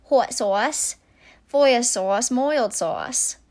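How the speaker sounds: put-on New York accent. Vlogger imitating accent banter with coworkers, New York accent. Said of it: "will talk in a New York accent".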